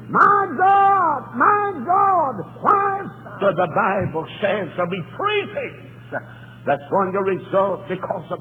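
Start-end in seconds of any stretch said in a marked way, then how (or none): 0.1-3.0 high, loud crying-out; anguished cry. Retold or imitated. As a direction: cry to God in agony from the cross. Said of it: "he shouted".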